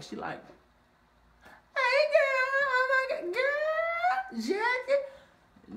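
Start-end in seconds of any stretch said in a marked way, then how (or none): 1.7-5.8 Brandy gushing over her surprised friend; squealing greeting. Retold or imitated.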